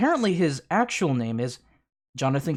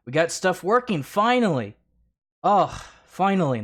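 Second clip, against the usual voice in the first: relieved excitement; 6 semitones higher, 3 dB louder than usual. Real, not acted. Stream finally works.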